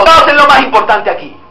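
loud, high, slow emphatic proclamation; shouted declaration. Acted. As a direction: shout it out as a parent's firm vow. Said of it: "se lo está gritando con su ejemplo".

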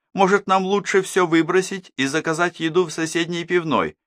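high mocking sing-song voice; mock them with exaggerated sweetness; sarcastic mockery. Sarcastic sister mocking family. (язвительная насмешка высоким голосом)

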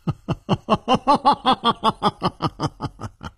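long rolling laugh, rising then falling; hearty laugh. A narrator's reading. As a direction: laugh at length, letting it tail off. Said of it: "然后他又大笑起来".

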